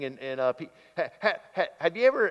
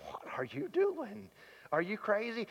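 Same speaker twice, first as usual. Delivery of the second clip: higher, disbelieving question to her husband; mock shock at a foolish choice; incredulous wife; 8 semitones higher, 3 dB quieter than usual. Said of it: "I can just see Missus Abrams saying".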